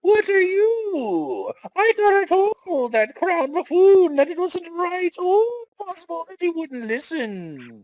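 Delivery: banished doctor scolding the princess in a high voice. Acted. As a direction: play a nervous, put-upon lab doctor. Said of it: "what voice do we give the doctor".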